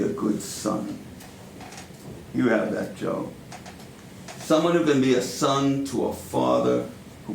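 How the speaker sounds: put-on deliberate New York-accented stage monologue, long pauses; Al Pacino-like monologue. Powerful mentor drawing Joe in like family, Pacino-style monologue. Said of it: "channeling Al Pacino".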